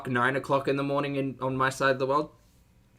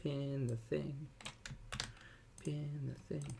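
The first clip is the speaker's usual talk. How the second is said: much softer, slow mutter to himself; muttered to self; 18 dB quieter, slower than usual. Real, not acted. Host muttering to himself while pinning a comment. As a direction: murmur it under your breath while busy.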